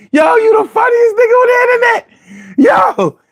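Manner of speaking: real excited yelling; excited shout. Real, not acted. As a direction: shout the praise out wildly. Hyped shout-out to commenter.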